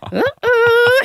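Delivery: high, loud mock uh-oh; comic alarmed noise. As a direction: squeal a cartoonish oh-no. Voicing her alarmed face.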